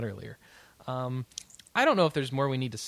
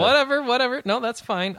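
exasperated; 10 semitones higher, 6 dB louder than usual. Real, not acted. Conceding argument loudly.

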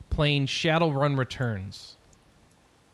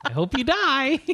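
high, bright sing-song wish; mock cheerful; 12 semitones higher than usual. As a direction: chirp it like good news.

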